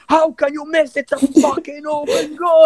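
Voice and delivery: high, exasperated yell at a teammate; can't believe you missed that, high outrage; outraged little brother. Retold or imitated. Little brother yelling at a player who missed, outraged high-pitched. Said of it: "and Mustafa's like".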